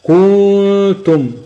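"كنتم" with the u stretched before the nasal hum; "كنتم" as koo, then the hum, then tum; wrong madd. Shown as a demonstration. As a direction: lengthen the vowel after the kaf in "كنتم", high and very slow. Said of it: "طب النطق الخطأ بتاعها إيه؟".

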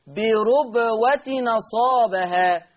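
بربوة نصابها very slow, ra with damma, tanween carried as nun; تلاوة ورش ببطء; Warsh naql recitation. Shown as a demonstration. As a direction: draw out بربوة نصابها slowly. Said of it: "هكذا".

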